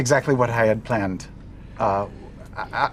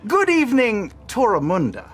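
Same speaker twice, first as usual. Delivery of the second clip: grand news address to the cities; a herald addressing two cities on air; pompous herald broadcast; 9 semitones higher than usual. Acted. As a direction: proclaim it like a town crier.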